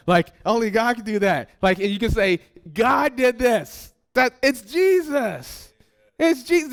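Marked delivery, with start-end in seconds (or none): 2.7-6.7 high, slow and amazed; excited realization. Acted. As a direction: marvel that it is Jesus.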